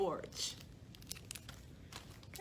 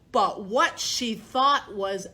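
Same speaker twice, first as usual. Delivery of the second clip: plain reading voice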